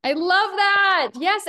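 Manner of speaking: high, loud and drawn-out delight; light up at the answer. Real, not acted. Coach cheering on a listener with high, slow delight.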